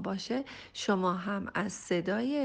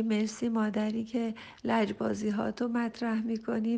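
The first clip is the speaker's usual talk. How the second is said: ordinary delivery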